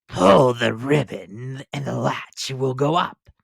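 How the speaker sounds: slow, softened wolf posing as granny; wolf softening his voice. A narrator's reading. Wolf in disguise coaxing the girl in, softened. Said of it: "softening his voice as much as he could".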